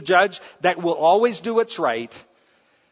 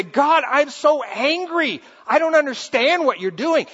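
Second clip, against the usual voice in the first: angry prayer; 10 semitones higher, 5 dB louder than usual. Retold or imitated. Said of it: "come say".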